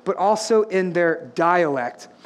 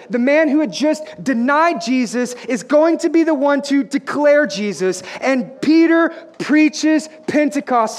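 full-force preaching; 4 semitones higher, 3 dB louder than usual. Real, not acted. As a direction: build toward a shouted announcement.

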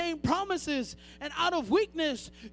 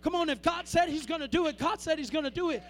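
ordinary delivery

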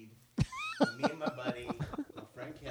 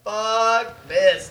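fed-up fuck this; 4 semitones higher, 12 dB louder than usual. Retold or imitated. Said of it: "I'm like".

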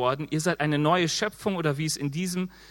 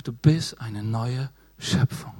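gentle Jesus voice; 6 semitones lower, slower than usual. Retold or imitated.

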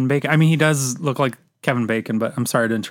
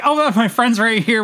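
higher, drawn-out mock excuse; nervous excuse; 9 semitones higher, slower than usual. Retelling the woman knocking on a random door, mock flustered. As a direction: play a flustered excuse, pitched up and stretched out.

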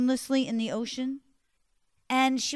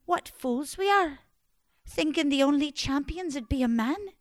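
a bit higher, bold declaration; defiant triumph; 5 semitones higher, 4 dB louder than usual. Acted. Margaret claiming the sword, lightly raised defiant pride. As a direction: proclaim it with pride.

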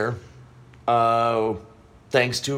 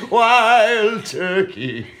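high, slow announcer drawl; drawn-out brand name; 8 semitones higher, 4 dB louder, much slower than usual. Pretend. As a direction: stretch it like a liquor ad. Joke on booze brand.

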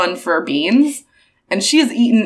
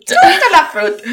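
higher, louder fake French repeat; mock French echo; 9 semitones higher, 5 dB louder than usual. Copying cohost's silly phrase in mock French. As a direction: parrot the nonsense French phrase.

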